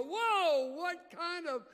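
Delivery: loud, high amazed whoa, slowing at the end; awed reader. Retold or imitated. Bible readers marvelling at heroes of faith, loud awe. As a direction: gush in mock amazement. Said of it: "you read about them and you go".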